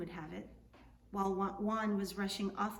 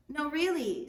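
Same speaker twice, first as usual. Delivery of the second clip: pushy caballero; 6 semitones higher, 7 dB louder than usual. Acted. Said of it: "said the caballero".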